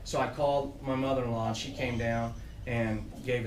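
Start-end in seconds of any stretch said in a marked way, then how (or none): none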